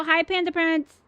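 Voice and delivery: usual speaking voice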